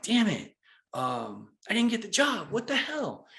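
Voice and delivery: mock frustration. Retelling frustration at losing job.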